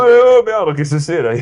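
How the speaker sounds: high, loud startled yelp. Acted. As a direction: jump at a noise in the dark woods. Acted fright at forest noise.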